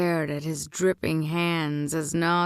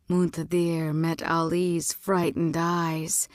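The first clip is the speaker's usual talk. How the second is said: ordinary delivery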